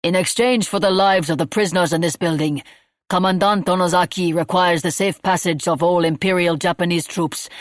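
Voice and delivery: loud, formal address over camp speakers; loudspeaker announcement. Acted. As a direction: announce terms over a crackling PA. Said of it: "the sound of Akibo's voice".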